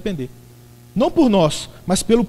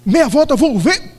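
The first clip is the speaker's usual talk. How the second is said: barked higher, louder drill order; army general's command; 6 semitones higher, 6 dB louder, slower than usual. Retold or imitated. Preacher imitating a general drilling his troops, mock commands. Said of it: "igual general de exército".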